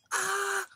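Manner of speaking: high, loud shriek; short piercing acted scream; imitated child's scream. Kid carrying on screaming at grandma, imitated shriek. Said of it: "So I start screaming".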